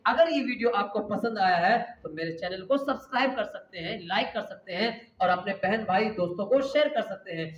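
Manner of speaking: ordinary talk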